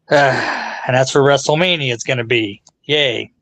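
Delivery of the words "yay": deadpan, unexcited cheer; mock enthusiasm with no lift in it; sarcastic fake excitement. Host sarcastic about where WrestleMania is going, mock-excited. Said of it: "hear the excitement in my voice".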